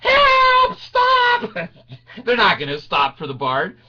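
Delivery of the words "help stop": high, full-voiced screams for help; screaming. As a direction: scream in hammed-up terror to stop the ride. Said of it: "I was screaming".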